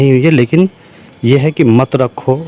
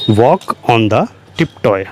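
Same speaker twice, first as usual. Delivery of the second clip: slow dictation; 2 semitones lower, much slower than usual. Shown as a demonstration. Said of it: "teacher repeats phrase for learners".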